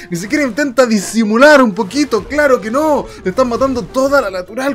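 loud excited play-by-play; excited rant. Real, not acted. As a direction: rant gleefully at the blunder. Excited caster ranting loudly.

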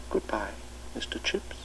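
"Goodbye, Mister Chips" breathed as a whisper; a memory whispered in his mind; whispered echo. A narrator's reading. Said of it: "echoing along the corridors of his mind".

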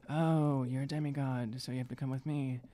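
ordinary talk

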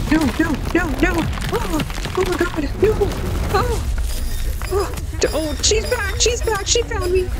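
high, frantic, breathless alarm; panicked fear. Real, not acted. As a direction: squeak in fear as she's caught. Chased by enemy in game.

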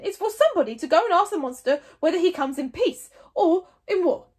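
loud, forced confidence; trying to sound in charge. Acted. Chief Stoic to his tribe, faking control loudly. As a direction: bluff authority over rising panic. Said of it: "trying to sound as if he was on top of the situation".

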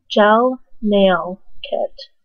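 "gel nail kit" drawn out word by word; slow careful repeat. Real, not acted. Vlogger repeating the product name for viewers, slow "gel nail kit".

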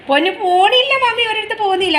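high-pitched coaxing of a pet; calling reassurance. Real, not acted. Mother tells pet she isn't going anywhere, high reassuring call. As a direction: reassure a fussing pet from afar, pitched well up.

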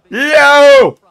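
very high, blasting yell; loud shout. Shown as a demonstration. Streamer testing if he is loud enough, big shout. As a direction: yell to test the mic. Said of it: "Feel like I'm not loud enough".

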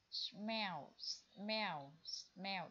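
"smell" repeated three times, clearly; model pronunciation. Shown as a demonstration. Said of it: "em sẽ đọc là". (đọc mẫu "smell" ba lần)

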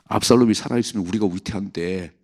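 normal talking voice

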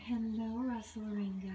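normal talking voice

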